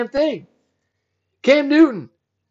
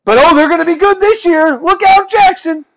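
much higher and louder mock excitement; sarcastic hype; 10 semitones higher, 9 dB louder than usual. Mocking the team's hype to listeners, sarcastic fake excitement. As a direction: oversell it, dripping with sarcasm.

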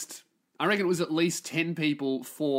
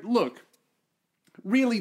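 ordinary talk

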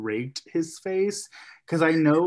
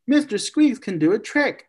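higher and louder, eager little sister; insistent kid; 9 semitones higher, 6 dB louder than usual. Retold or imitated. Sister insists to brother, higher and louder. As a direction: push it up high and loud, insisting. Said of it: "she's like".

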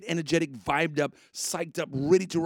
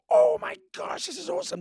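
breathy loud gasp, then a high squeal; excited exclaim; 5 dB louder than usual. Retold or imitated. Retelling the pastor in prayer, mock delighted. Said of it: "Pastor was saying".

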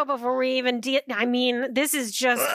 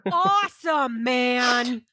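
dude voice; much slower than usual. Retold or imitated. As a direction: hype them up like a dude. Voicing a cheering student.

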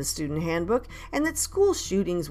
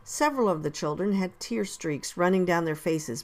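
usual speaking voice

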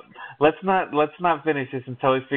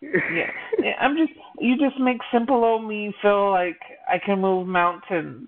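mock emotional; 7 semitones higher than usual. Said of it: "continues mock emotional speech".

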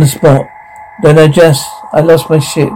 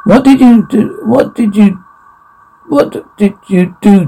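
high, shaken demand; shocked fear; 5 semitones higher than usual. Acted. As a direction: gasp the question. Waking confused and frightened.

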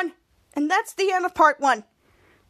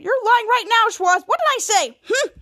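arguing; 6 semitones higher than usual. Retold or imitated. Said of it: "they're still arguing".

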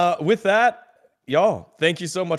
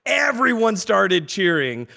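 normal talking voice

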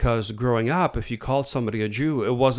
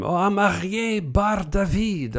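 proud guide; 9 semitones higher, slower than usual. Retold or imitated. Guide proudly states name.

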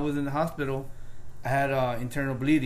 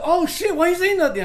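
shocked nurse; 7 dB louder than usual. Retold or imitated. Shocked nurse quoted in story.

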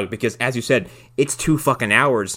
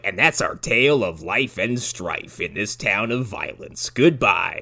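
narrator sign-off; 3 semitones lower, slower than usual. Retold or imitated. [name] ending the film, slow storyteller sign-off. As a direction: close the tale like an old storyteller. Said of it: "goes like".

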